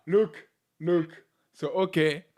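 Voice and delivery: ordinary delivery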